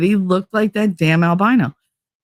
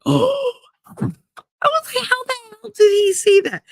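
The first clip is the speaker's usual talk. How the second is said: high-pitched mock shock. Telling of being caught with hickeys, high shocked squeal. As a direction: stretch it out in a high, stunned squeal.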